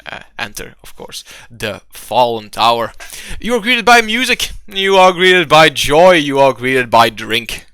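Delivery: grand, emphatic tavern welcome; game master scene setting. Acted. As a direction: announce each delight with mounting showmanship, then settle.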